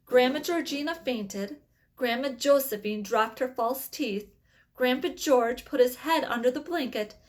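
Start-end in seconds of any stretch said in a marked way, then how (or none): none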